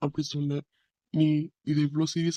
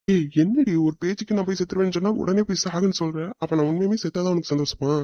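higher and louder outraged "hey"; shocked protest; 3 semitones higher, 3 dB louder than usual. Acted. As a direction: protest in disbelief. Shocked she tells him die. (அதிர்ச்சியுடன் கூச்சல்)

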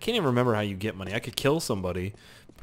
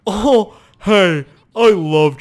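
excited shout; 7 semitones higher, 11 dB louder, much slower than usual. Real, not acted. Excited at the food.

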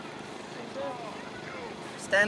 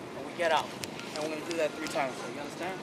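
quiet team talk; 7 semitones lower, 14 dB quieter than usual. Real, not acted. Coach quietly briefing team.

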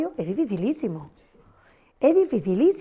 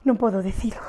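a secretive whisper; faster than usual. Shown as a demonstration. Teacher teasing the class with a secret, whispered. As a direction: hush it like a secret you won't tell. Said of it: "xogo coa expresión".

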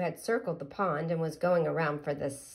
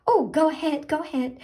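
higher, eager urging; excitedly; 9 semitones higher, 4 dB louder, faster than usual. Acted. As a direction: hurry her on in excitement. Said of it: "the pollywog said excitedly".

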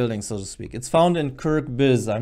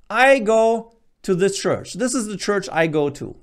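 higher, emphatic voice of imagined churchgoers; churchgoers pointing out their church; 7 semitones higher, 3 dB louder than usual. Retold or imitated. Early Christians pointing to their church building, higher and emphatic. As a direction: lightly act a churchgoer laying claim to the building. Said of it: "pointing to this building and saying".

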